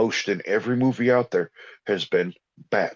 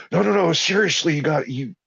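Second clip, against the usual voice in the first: insistent; 7 semitones higher than usual. Retold or imitated. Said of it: "he was mad".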